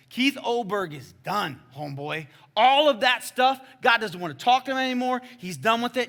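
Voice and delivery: emphatic proclamation, rising high and loud; talking loud. Retold or imitated. Preacher baptizing man declares old self done, loud. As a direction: declare the old life finished at full voice. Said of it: "I'm talking loud".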